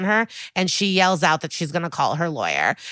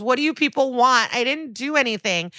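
higher-pitched imitation of a crying girl; pitched-up, put-on tearful protest; mock crying protest; 7 semitones higher than usual. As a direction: wail it lightly, as a retold plea. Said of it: "Katie cries".

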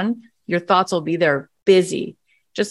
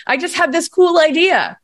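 high, louder burst of delight; excited; 10 semitones higher, 4 dB louder than usual. Retold or imitated. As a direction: blurt it out thrilled.

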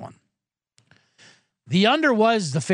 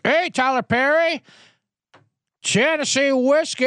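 slow, higher, half-sung hello from a self-absorbed star; 8 semitones higher than usual. Retold or imitated. Host mimics the singer answering [name], slow and crooned. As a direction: croon the greeting like it's a song. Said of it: "that's how he says hello".

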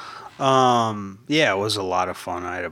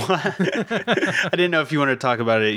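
laughing; 6 semitones higher than usual. Real, not acted. Interviewer cracks up over awkward question.

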